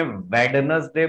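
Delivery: "वेडनेसडे" as "wed-nes-day"; three syllables with audible d in "वेडनेसडे"; sounded D, wrong. Shown as a demonstration. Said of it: "वेडनेसडे pronounce नहीं करेंगे". ("वेडनेसडे" में डी साफ़ बोलें)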